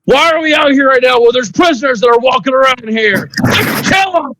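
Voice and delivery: high, furious shouted rant; angry ranting. Acted. Angry masked man yelling at Doctor Maruki. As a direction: rage at the doctor, demanding the prisoners die. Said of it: "he's just extremely angry".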